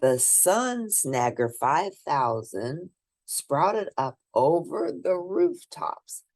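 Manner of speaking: ordinary delivery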